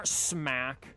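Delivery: normal talking voice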